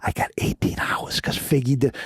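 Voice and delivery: a hushed, conspiratorial whisper; whispered reveal. Acted. Inmate telling the host his big news, in a whisper. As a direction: whisper it like a secret. Dramatic secret reveal in story.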